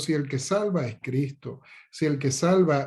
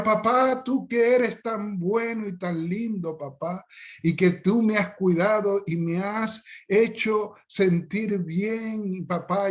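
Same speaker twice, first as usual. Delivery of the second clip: much higher, slow, syrupy mock plea; pleading child; 6 semitones higher, slower than usual. Child keeps flattering father for an ice cream, high slow plea. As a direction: stretch every word like a child begging.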